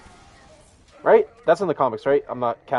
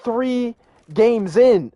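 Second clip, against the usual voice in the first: higher, louder mock complaint; mad Angry Joe; 8 semitones higher, 5 dB louder than usual. Imitating a fan demanding respect for his hero, loud and indignant. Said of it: "he was mad".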